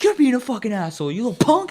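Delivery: loud, high insult; mock furious berating; angry stranger yelling. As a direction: imitate an irate man scolding a kid. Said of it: "You're fucking yelling at me first".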